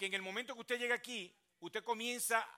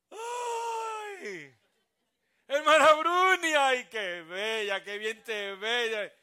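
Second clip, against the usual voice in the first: loud, high, slow gushing cry; gushing fake compliment; 4 semitones higher, 16 dB louder, much slower than usual. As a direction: overdo the delight in Bruni. Fake gushing greeting to Bruni.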